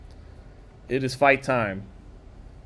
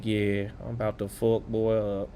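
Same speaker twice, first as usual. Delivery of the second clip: bad Texas accent; 5 semitones lower, 10 dB quieter than usual. Commentator voicing the grinning boxer, bad Texas accent. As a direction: put on a hokey Texas accent, quietly. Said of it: "that's my bad Texas accent".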